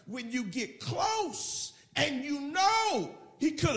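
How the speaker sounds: high, slow, stretched-out cry; impassioned preaching shout. Real, not acted. Preacher telling the congregation about getting close, impassioned shout. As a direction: drive it home to the congregation, drawing out the words.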